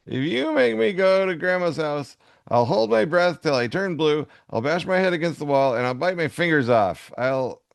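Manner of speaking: mock threats of a spoiled kid; child tantrum. Co-host reading the tantrum side, hammed-up child tantrum. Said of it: "bad habits, throwing tantrums".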